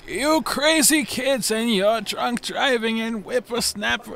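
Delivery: mock a cranky old man scolding kids; hammed-up old geezer rant; grumpy old man.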